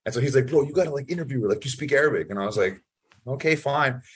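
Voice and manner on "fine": ordinary talk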